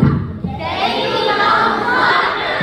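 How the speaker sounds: slow group yell of Davy Jones' locker; shouted. Real, not acted. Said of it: "Can I hear you say".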